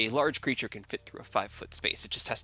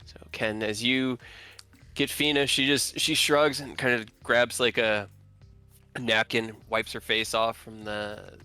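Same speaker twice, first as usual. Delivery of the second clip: ordinary talk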